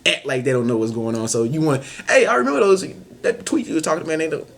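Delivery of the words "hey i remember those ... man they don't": teasing friend. Acted. Acted friend bringing up tweet.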